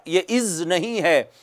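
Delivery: usual speaking voice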